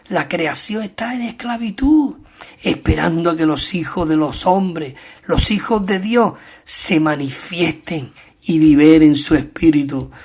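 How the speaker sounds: ordinary delivery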